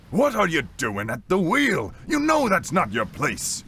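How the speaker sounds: first mate telling off a deckhand; angry first mate. Acted. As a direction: demand answers loudly, outraged.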